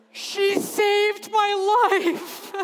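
high, loud, very slow choked-up words; overcome with emotion. Retold or imitated. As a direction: speak as if barely holding back tears. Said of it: "And he's like".

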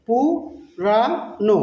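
very slow "পু - রা - নো"; three separate beats on "পুরানো", gaps between; broken into syllables. Shown as a demonstration. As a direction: sound out "পুরানো" syllable by syllable, slowly. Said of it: "নেপালিতে বলবো".

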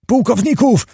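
high-pitched calling out without extra volume; menacing crowd calls. A narrator's reading. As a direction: chant it like angry voices from the yard. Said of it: "wołały groźne głosy z podwórza". (groźne wołanie z podwórza)

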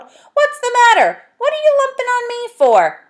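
very high, loud put-on old man voice; grumpy saucepan man.

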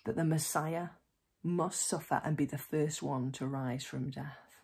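usual speaking voice

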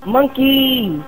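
high, drawn-out gleeful call; excited cry. Real, not acted. Player delighted by monkeys, excited high call. Cheers on getting an item.

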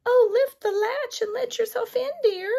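high frail grandmother voice; lightly voiced old granny; old woman voice. A narrator's reading. Grandmother answers from bed.

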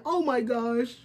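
choked-up oh my gosh; crying happy; tearful. Real, not acted. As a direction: near tears with joy. Overwhelmed by shoe.

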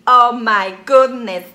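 slow, loud oh my goodness; loud drawn-out exclamation. Real, not acted. Reacting to the color name Take Me: loud slow exclamation. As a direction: savour the exclamation.

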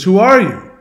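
high, loud demand; demanding question. Retold or imitated. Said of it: "So he talks to her".

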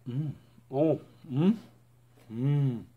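muffled closed-mouth hum; chewing stringy cheesy bite; mouth full chewing. Real, not acted. Seen 0: Just bit calzone; cheese string hangs from closed chewing mouth.

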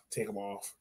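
ordinary talk

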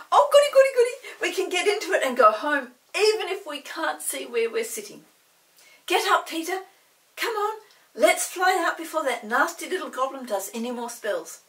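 put-on loud, high gleeful girl; girl thrilled to fly home; delighted. A narrator's reading. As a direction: bubble over with joy. Molly delighted, urging escape.

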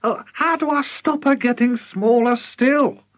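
high, worried wail; high-pitched desperate plea; distraught cry. A narrator's reading. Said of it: "and she cried".

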